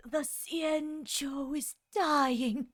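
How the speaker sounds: slow, strained, as if in pain; mock pained heroine, slow; pained character voice. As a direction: drag it out like it hurts. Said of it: "sounds like she's constantly in pain".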